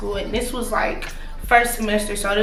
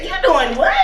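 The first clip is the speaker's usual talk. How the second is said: surprised; 5 semitones higher, 4 dB louder than usual. Retold or imitated. Excited retold reaction.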